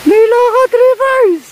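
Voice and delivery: high, loud exclaiming to viewers; dizzy exclamation. Real, not acted. Vlogger calling out to viewers, loud and high. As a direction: cry out the dizziness, half laughing.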